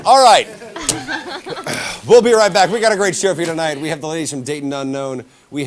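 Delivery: loud, high show host outro; big announcer voice to break. Acted. Host throws to commercial break.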